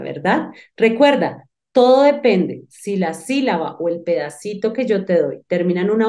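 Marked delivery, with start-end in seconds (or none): none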